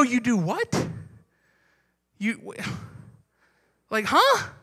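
a stammering, confused huh; mock bafflement. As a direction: pastor acting out an outsider's confusion over unpaid serving. Outsider baffled by serving.

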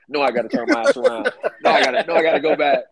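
high, loud, laughing panic; yelling at himself to turn around, half laughing; frantic second thoughts. Retold or imitated. Panicked inner voice turning back.

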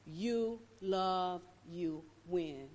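low, soft, slow sermon; hushed preaching. Acted. Preacher speaking low and soft to his listeners. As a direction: pull them in close.

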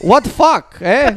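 put-on indignant old Polish grandpa; hammed-up Polish grandpa disbelief. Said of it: "is Polish".

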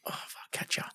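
urgent whisper. Retold or imitated. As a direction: whisper it under your breath. Urging self on during ride.

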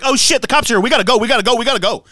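high loud panic; panicked alien. Retold or imitated. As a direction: scramble to flee the cops. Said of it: "They're like".

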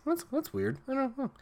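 mock mild puzzlement in a high voice; unconcerned mom. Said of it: "she just seems like".

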